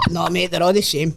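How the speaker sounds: ordinary talk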